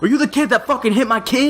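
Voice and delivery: retold mom confronting him over the fight, mock yelling; mock screaming mom. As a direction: ham up the mom yelling at him, half-laughing. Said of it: "she's, like, screaming at me".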